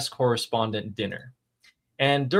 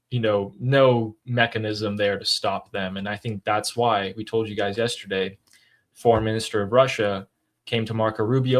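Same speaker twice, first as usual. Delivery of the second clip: ordinary talk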